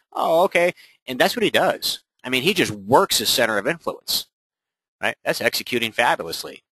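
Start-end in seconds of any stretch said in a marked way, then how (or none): 0.1-0.7 higher "Oh, okay" of surprised acceptance; mock contact agreeing. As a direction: accept it, a little pitched up.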